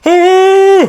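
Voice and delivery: high, loud shriek of alarm; mock-terrified high scream; frightened scream. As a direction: scream as a monster appears. Sailor sees the dragon.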